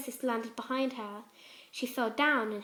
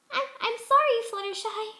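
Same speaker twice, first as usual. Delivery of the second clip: much higher, trembling apology; small frightened voice, louder; scared pony voice; 11 semitones higher, 6 dB louder than usual. A narrator's reading. Pinkie voiced, ashamed.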